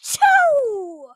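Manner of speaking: loud, high achoo; big exaggerated sneeze. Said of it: "Wait, I gotta sneeze".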